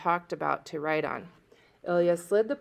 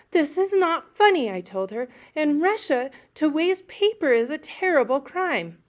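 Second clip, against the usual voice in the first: high, louder indignant protest; high offended insistence; 9 semitones higher, 5 dB louder than usual. A narrator's reading.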